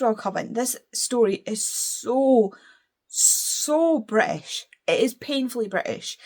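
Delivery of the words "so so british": drawn-out emphasis. Real, not acted. Emphatic verdict on the cover.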